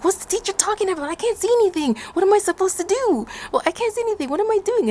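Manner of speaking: high, confused panicking thoughts; panicked inner voice. Retold or imitated. Girl in her head, panicking over the warning. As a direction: think in a panic, lost. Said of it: "I'm panicking".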